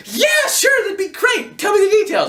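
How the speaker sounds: put-on very high, loud squeaky girl voice; imitating her with a high shout; girly squeal. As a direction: squeal it like an overeager teen girl. Quoting date's eager text reply.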